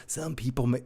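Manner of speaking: lightly imitated movie star, low and soft; soft, low stab at an actor's voice; Jack Nicholson. Host retrying the movie quote, low quiet imitation. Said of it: "say that like Jack Nicholson".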